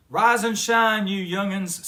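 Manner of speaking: loud, bright morning rouse; booming grandpa wake-up call; hearty wake-up call. A narrator's reading. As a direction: call the cubs out of bed cheerfully. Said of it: "said Gramps".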